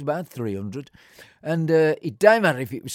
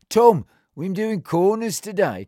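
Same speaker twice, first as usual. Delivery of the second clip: higher, louder call to a man far off; raised call across the grass; calling across the pitch; 6 semitones higher, 6 dB louder than usual. Retold or imitated. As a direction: shout it over the field to old Tom.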